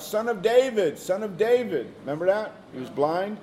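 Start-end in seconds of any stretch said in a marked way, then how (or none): 0.0-1.9 mildly raised, higher-pitched call; retold crying out. Blind man calls to Jesus as he passes, crying out. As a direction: call out as if quoting a plea. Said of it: "He cried out".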